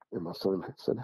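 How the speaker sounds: ordinary talk, nothing marked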